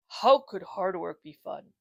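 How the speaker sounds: ordinary delivery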